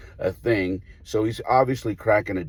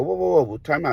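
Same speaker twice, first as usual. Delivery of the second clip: higher, urgent call to stop play; calling time; 6 semitones higher than usual. Retold or imitated. Coach calling time out to the umpire, urgent and raised. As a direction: halt everything right now.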